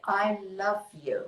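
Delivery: softly spoken I love you; tender. Shown as a demonstration. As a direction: say it to yourself gently. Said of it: "remember to say".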